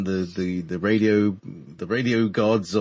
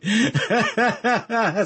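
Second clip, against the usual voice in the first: high burst of laughter; 13 semitones higher than usual. Real, not acted. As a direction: break into laughter. Laughs at own trouble with R.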